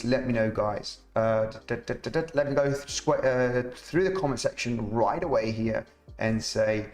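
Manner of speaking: ordinary talk, nothing marked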